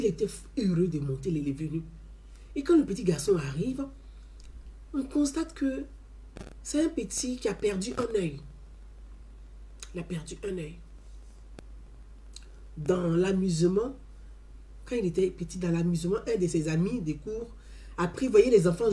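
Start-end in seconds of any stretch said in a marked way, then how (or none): none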